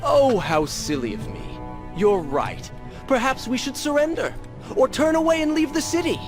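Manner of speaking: high, singsong sarcasm; mocking. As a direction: feign agreement to needle your companion. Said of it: "If it comforts you to mock me".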